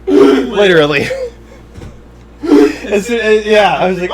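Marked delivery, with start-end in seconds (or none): none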